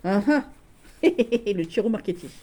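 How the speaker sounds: normal talking voice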